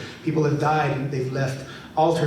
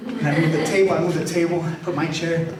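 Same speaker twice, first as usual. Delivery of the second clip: ordinary talk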